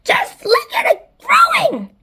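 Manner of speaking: high, loud excited squawk; high-pitched astonished shout; crying out. A narrator's reading. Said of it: "and Spiker cried".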